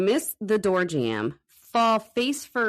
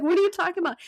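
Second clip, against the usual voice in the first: confused reply; 12 semitones higher, much faster than usual. Retold or imitated. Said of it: "I'm like".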